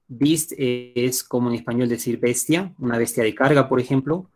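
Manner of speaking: usual speaking voice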